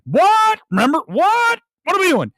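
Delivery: high, loud incredulous what; mock disbelief. Reviewer mocking fans who call the film good, incredulous. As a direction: squeal it out in mock outrage at the praise. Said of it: "people are saying it's good is like".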